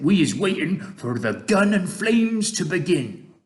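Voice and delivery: lifted, eager giant voice; BFG giant character, expectant. Acted. BFG telling Sophie what they await, expectant.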